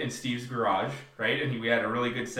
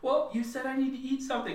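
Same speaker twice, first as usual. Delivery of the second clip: put-on small, high kid voice, softer; high, meek child's protest; pleading child; 12 semitones higher, 4 dB quieter than usual. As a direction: imitate a hurt little boy explaining himself. Retells the pleading child's reply.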